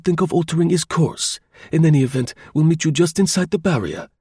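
high, urgent voice of command; curt, authoritative. A narrator's reading. Chauvelin hurries Tournefort off with orders, curt and authoritative. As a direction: send him off with firm orders, pitched well up. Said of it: "he gave a curt command".

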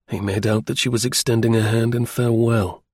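usual speaking voice

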